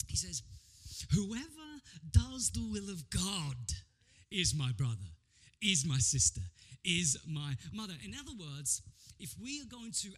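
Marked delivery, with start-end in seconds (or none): 1.1-7.9 weighty punchline delivered to a congregation; solemn proclamation. Acted. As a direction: proclaim each phrase with a pause after it. Jesus's punchline, declaimed.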